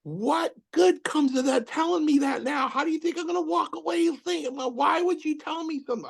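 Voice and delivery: very high, thin mock voice; squeaky mimic. Mimicking Pat's complaint in a high put-on voice. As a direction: squeak it out in a mocking falsetto. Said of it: "I go".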